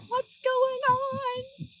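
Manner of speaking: high, slow, dazed "What's going on?"; puzzled cat voice. Retold or imitated. Reading to children, voicing the baffled cat in a high slow squeak. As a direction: play the stunned cat, squeaky and drawn out. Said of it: "the cat's looking up like".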